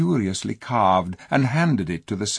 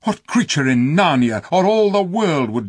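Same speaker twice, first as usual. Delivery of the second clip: fierce king; 7 semitones higher, 6 dB louder than usual. A narrator's reading. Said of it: "said the king fiercely".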